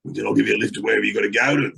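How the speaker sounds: lightly imitated croaky old copper. Old copper offering to let him go, croaky imitation. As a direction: start low and creaky, like an old cop. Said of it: "He's that old croaky voice".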